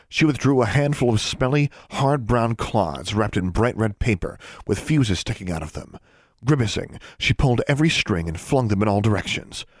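plain reading voice

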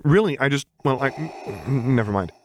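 high, stammering start that sinks away; stumble, then give up on the sentence; flustered cutting off. A narrator's reading. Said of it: "He quickly cut off".

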